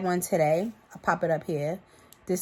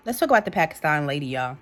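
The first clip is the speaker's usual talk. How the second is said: normal talking voice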